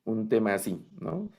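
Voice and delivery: usual speaking voice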